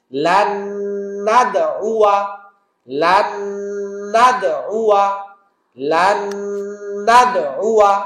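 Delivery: লান নাদ উয়া with ghunna on নুন and a bouncing দাল; repeated model recitation. Shown as a demonstration. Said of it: "গুন্নার মতো গুন্না করব".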